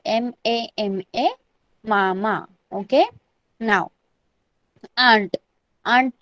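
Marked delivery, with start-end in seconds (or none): 0.1-2.5 spaced letters, then मामा lower, as मा-मा; spelling out pronunciation. Shown as a demonstration. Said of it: "कैसे pronounce करना है".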